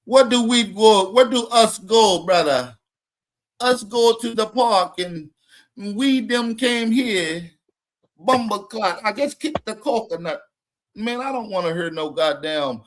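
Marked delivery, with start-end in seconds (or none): 0.1-10.4 loud, hammed-up Jamaican patois imitation; mock Jamaican accent. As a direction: mimic broken Jamaican English to ridicule it. Mocking Jamaican immigrants' English.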